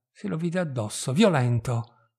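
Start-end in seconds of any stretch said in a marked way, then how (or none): none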